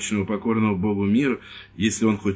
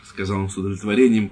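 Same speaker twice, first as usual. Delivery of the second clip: usual speaking voice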